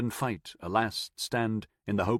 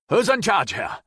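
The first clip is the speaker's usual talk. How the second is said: higher, louder breathless call; confident call; 9 semitones higher, 5 dB louder than usual. A narrator's reading. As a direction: call out confidently though winded. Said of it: "called a confident voice, out of breath".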